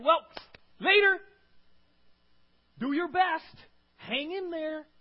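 high, loud breezy goodbye; dismissive send-off. Acted. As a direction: toss out a mock cheerful brush-off. Mimics someone abandoning you cheerfully.

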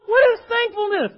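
high, loud exclaimed question; fired-up, high-pitched preacher's shout; exasperated outburst. Shown as a demonstration. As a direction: burst out with it, cutting through the stuffiness. Breaks off dry study, exclaims.

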